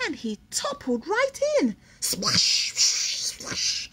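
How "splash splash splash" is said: breathy splash sound effect; whispered splash. Acted. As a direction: hiss the splashes out.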